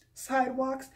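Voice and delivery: normal talking voice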